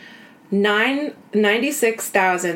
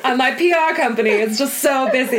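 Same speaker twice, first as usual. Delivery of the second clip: a light, half-British socialite voice; breezy half British accent, barely there; half British Samantha Jones; 3 semitones higher than usual. Retold or imitated. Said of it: "that's my Samantha Jones".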